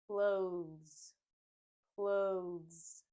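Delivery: Clothes said like "cloze", strong z ending; th skipped in clothes. Shown as a demonstration. Teacher modelling clothes for learners, th skipped. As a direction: drop the th, buzz the z in clothes. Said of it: "we basically skip it".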